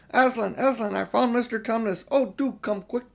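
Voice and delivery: high, eager calling; shouting out. A narrator's reading. Lucy calling Aslan after finding Tumnus, excited shout. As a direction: call out to Aslan in delight. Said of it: "shouting out".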